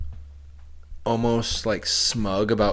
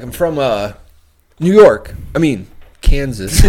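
Christopher Walken; 3 semitones higher, 7 dB louder than usual. Retold or imitated. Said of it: "Christopher Walken, when he's like".

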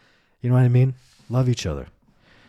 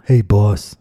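mocking boss greeting; 8 dB louder than usual. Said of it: "I don't like boss".

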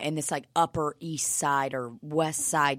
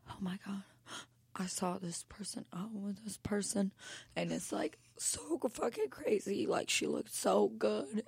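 breathy, scandalized near-whisper, pitched up; hushed gossip; 4 semitones higher, 11 dB quieter than usual. Acted. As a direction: whisper juicy gossip in mock shock. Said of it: "they're like".

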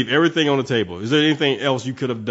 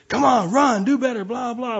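much higher mock yell of a soccer dad; mock sideline yell; 10 semitones higher than usual. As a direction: ham up a dad yelling from the sideline. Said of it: "they're screaming at the kids".

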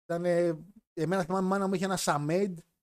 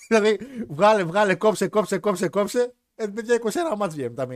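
loud, high-pitched rattle of repeated words; loud, amused exaggeration; 5 semitones higher, 8 dB louder than usual. Real, not acted. Host joking to listeners about cutting matches, loud and exaggerated. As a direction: pile it up loudly after a laugh.

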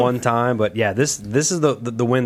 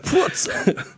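laughing. Retold or imitated. Mocks the video-game voice again.

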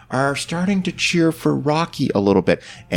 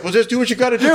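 imitating Duke; 11 semitones higher, much faster than usual. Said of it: "Duke's like".

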